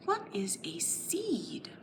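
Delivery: whispered "What is a seed?"; curious whisper. Acted. Teacher whispering a question to draw children in. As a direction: wonder aloud in a hush.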